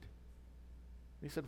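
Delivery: ordinary talk, nothing marked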